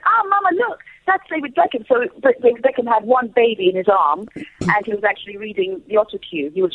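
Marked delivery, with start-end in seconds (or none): 0.1-1.8 high, imitated little-boy voice; excited young son. Son pointing out a footballer to his mother, imitated high child voice. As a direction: pipe up like a child spotting someone famous.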